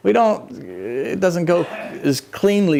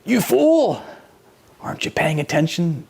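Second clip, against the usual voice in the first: the Lord rebuking; 2 semitones higher than usual. Pretend. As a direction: snap them out of it. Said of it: "the same thing he told the disciples".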